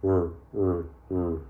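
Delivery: R sound repeated, drawn out as "R, R, R"; teacher to class, modelling the R sound clearly; letter sound demo. Shown as a demonstration. Teacher to class, sounding out the letter. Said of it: "what sound that makes".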